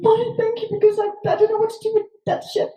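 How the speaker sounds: high fast relieved babble; high fast grateful rush; high relieved gush. Retold or imitated. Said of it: "I'm like".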